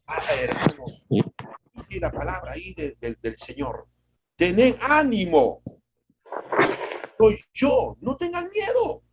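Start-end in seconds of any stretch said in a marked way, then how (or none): 4.4-9.0 voicing Jesus. Jesus's words to the disciples.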